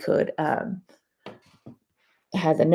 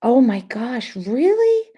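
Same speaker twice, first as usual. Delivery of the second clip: jumps much higher and louder on really; shocked; 6 semitones higher, 8 dB louder, slower than usual. Real, not acted. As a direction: genuine disbelief, voice flying up. Shocked reaction to a comment.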